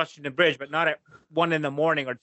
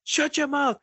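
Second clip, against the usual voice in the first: higher, angry retold yell; yelling at the kids; 10 semitones higher than usual. Dad losing it at his arguing kids in the car, angry yell. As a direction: snap at the squabbling kids in the back. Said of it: "so I'm just going off".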